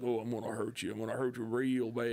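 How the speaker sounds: a mild mock cowboy twang; Southern accent. Critic mocking the remake Freddy's accent, light Southern impression. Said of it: "he gave him this accent".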